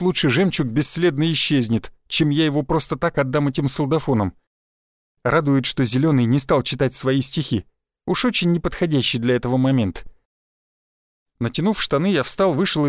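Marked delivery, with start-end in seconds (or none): none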